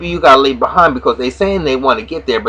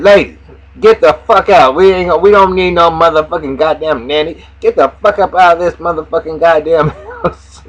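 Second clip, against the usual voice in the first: louder, higher, furious bark at the nannies; Lucius yelling; 4 semitones higher, 3 dB louder than usual. Retold or imitated. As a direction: chase them out of the house, yelling. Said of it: "so he said".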